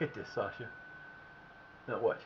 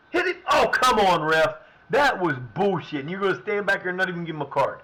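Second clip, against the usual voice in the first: angry yell at ref; 3 semitones higher, 6 dB louder than usual. Real, not acted. Gamer yells at the referee after a foul, furious shout. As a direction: let the ref have it, furious.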